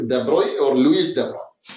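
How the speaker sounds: de Broglie said as "duh BROY", alone and after Louis; both de Broglie endings rhyme with "boy"; original name. Shown as a demonstration. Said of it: "His original name is".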